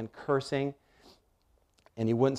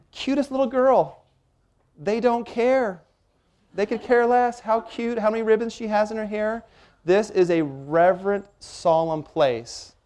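emphatic; 9 semitones higher than usual. Real, not acted. Emphatic aside about the guards.

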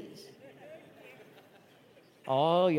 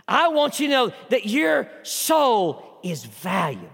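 ringing preacher's build to a shout; preaching at full force; 2 semitones higher than usual. Acted. Pastor preaching hard to the congregation, full force. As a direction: drive the point home to the church.

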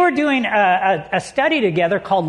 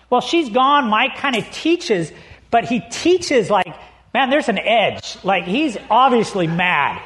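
usual speaking voice

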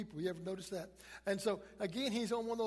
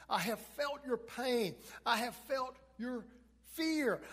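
raised, slow, forceful preaching; emphatic declaration; 4 semitones higher, 3 dB louder, slower than usual. Acted. Preacher voicing Jesus to the congregation, emphatic and slow. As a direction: drive each phrase home as a heartfelt vow.

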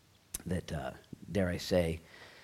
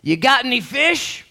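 loud, high, slow call across the water; calling out from the beach; 14 dB louder, slower than usual. Acted. Jesus on the beach calling out to the fishermen in a loud, high hail. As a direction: call out to the boat far offshore. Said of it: "a voice from the beach that cried out".

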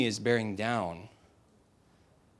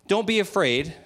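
higher, louder, fed-up "Don't be afraid"; exasperated Moses; 7 semitones higher, 4 dB louder than usual. Retold or imitated. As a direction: snap it out like an annoyed leader. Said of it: "I don't think Moses was very happy here".

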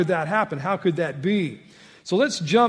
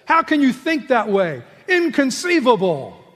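indignant disbelief; 7 semitones higher, 7 dB louder than usual. Retold or imitated. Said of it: "That's what Paul said".

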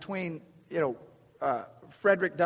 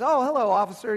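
cheery greeting; 7 semitones higher, 7 dB louder than usual. Retold or imitated. Refreshed friend greets officer.

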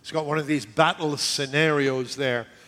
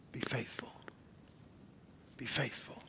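whisper; 3 semitones lower, 18 dB quieter than usual. Real, not acted. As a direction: lower whispered plea. Whispered repetition.

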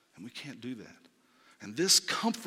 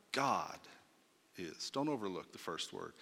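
ordinary talk

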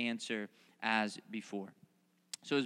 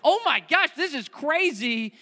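loud high shriek of alarm; mock panic; 12 semitones higher, 9 dB louder than usual. Congregant seeing a lion at the car, panicked shout. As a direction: freak out at the lion. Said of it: "you're like".

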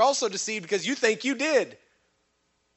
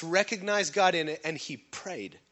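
ordinary delivery

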